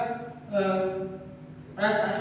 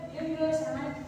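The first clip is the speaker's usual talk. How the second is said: higher, softer quoted "coming"; retold hurried reply; 8 semitones higher, 5 dB quieter than usual. Relative answering the nurse's call, retold high and soft.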